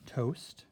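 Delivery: Toast said apart from er; syllable split. Shown as a demonstration. Teacher showing the first syllable of toaster. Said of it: "those are the two syllables".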